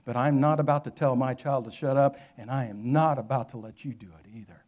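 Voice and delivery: loud, emphatic, restrained protest; firm voice. Retold or imitated. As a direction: imitate a protective mother holding firm. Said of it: "but yet with a firm voice".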